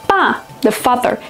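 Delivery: ordinary talk, nothing marked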